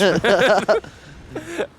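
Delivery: loud burst of laughter. Real, not acted. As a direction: laugh out hard, high. S0 laughs at girlfriend joke.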